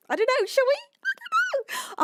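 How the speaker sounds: very high, excited squeak; giddy squeal. Real, not acted. Streamer to viewers near a follower milestone, giddy squeal. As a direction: let the pitch shoot up into a giddy squeal.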